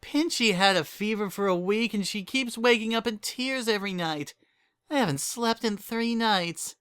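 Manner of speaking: a sleepless mother venting to a friend; exhausted Berry Punch. Acted.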